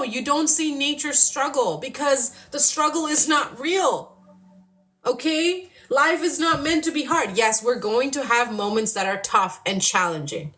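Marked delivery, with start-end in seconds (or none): none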